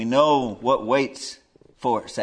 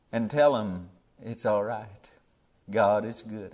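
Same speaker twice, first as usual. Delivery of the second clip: normal talking voice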